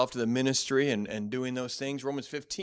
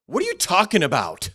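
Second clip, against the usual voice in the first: loud, higher challenge on you; angry demand; 7 semitones higher, 10 dB louder than usual. Shown as a demonstration. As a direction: snap it back angrily. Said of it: "Same words".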